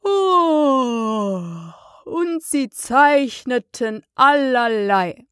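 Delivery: long yawn into slow, dragging words; yawning, sleepy. A narrator's reading. Dormouse growing very sleepy, yawning through its tale. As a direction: yawn widely and talk through it. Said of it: "Hier gähnte es".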